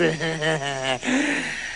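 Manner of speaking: low, gloating chuckle; evil farmer's low cackle; wicked laugh. Acted. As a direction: chuckle darkly at your victim.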